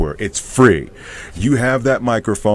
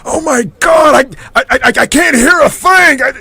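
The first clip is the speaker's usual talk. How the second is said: character voice Tony; 8 dB louder than usual. Acted. Voice actor shows his character Tony to the host, high loud shouting. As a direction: yell it like a man hard of hearing begging for volume. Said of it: "my guy Tony".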